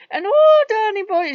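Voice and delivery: high, loud mock-Irish crooning; hammed-up Irish accent. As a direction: belt it out like a stage Irishman. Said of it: "Lou would start it because he thinks it's hilarious".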